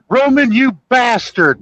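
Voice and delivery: high, hammed-up yell at Roman; mock yell. Retelling John Candy water skiing, high mock yell. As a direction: yell it up high at the guy driving the boat. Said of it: "He goes".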